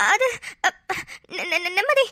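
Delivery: stuck on first syllables; nervous stammer. Acted. Pleading with the girl who caught her, nervous stammer. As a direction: falter and restart, lost for an excuse. Caught, cannot explain herself.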